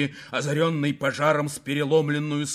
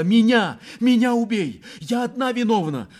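high, loud, desperate demand; fierce high-pitched plea; impassioned cry; 10 semitones higher, 5 dB louder than usual. A narrator's reading. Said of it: "вскричала она".

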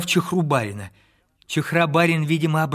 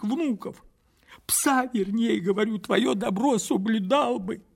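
put-on higher, earnest old servant begging; higher, imploring old wanderer; pleading old man; 9 semitones higher than usual. A narrator's reading. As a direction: beg him like a loyal dog. Old man pleads his case.

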